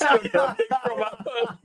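high laugh-through telling; giggling while telling the prank. Real, not acted. Teller cracking up at his prank, laughing.

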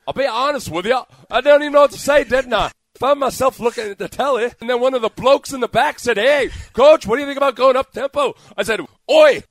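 high-pitched mock Liverpudlian accent. Host imagines a British coach coming to America, Liverpudlian accent. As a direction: put on a high, animated Liverpool accent, building to a yelled Oi. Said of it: "that was my Liverpudlian accent".

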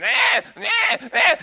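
shrill high mock kid scream, loud; loud squealing imitation of a screaming child. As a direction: imitate a bratty toddler wailing in a restaurant. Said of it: "the kids can be screaming".

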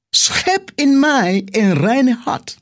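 slow, lifted reading of the verse; slow scripture reading. Acted. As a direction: read the psalm slowly, with reverence.

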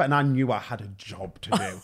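usual speaking voice

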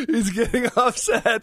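slow, high, laughing taunt; laughing tease. Real, not acted. Co-host laughingly tells the audience his partner is upset. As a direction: drawl it out through laughter.

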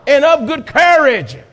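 loud rallying cry; shouted exhortation. Acted. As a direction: rouse the whole room. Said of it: "participate in this message".

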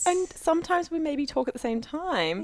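high, prim mock-offended voice; mock defensive. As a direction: protest too much, sweetly.

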